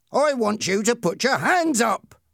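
high-pitched bullying demand; shouted order. A narrator's reading. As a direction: bark the order at a captive. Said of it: "Ernie shouted at him".

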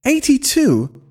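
very high, incredulous exclamation; shocked outburst, pitch shooting up without much volume; shocked shout. A narrator's reading. Husband learning his wife's age, shouting in shock. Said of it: "I shouted, almost falling down with shock".

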